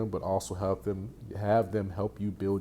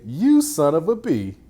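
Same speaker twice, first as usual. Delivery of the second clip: higher, louder son of a B; mock angry insult; 7 semitones higher, 8 dB louder than usual. Shown as a demonstration. Coach showing the angry take of the same words. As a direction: spit it out like you mean it. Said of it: "or you could say".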